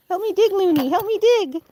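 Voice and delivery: sing-song urging, pitch rising each time; high dog talk. Real, not acted. Owner coaxing her dog Looney to dig, high sing-song.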